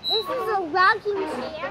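loud announcing. Real, not acted. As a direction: announce it loudly. Child announcing loudly.

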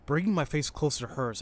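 ordinary delivery

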